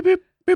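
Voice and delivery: high boops like a checkout scanner; imitated scanner beep. Wife imitating the self-checkout scanner beeping. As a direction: chirp the beeps high.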